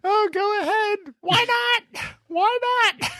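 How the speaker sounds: very high, squealed go-ahead; mock exasperation. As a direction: give in with hammed-up squeaky outrage. Mock squabble in high voices.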